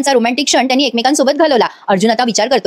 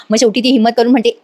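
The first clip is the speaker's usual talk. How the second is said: normal talking voice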